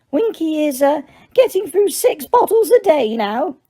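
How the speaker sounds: high squeaky elf voice, hushed; Dobby confides in Harry, high and hushed; Dobby whispering. A narrator's reading. Dobby tells Harry about Winky in a high elf voice. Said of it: "Dobby whispered to Harry".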